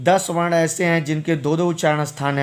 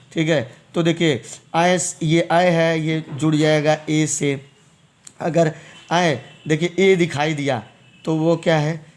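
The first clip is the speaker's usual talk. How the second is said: usual speaking voice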